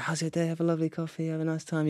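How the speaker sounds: high, soft chirpy server voice; sweet customer-service singsong; cheery barista. Retold or imitated. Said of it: "you go to the barista and like".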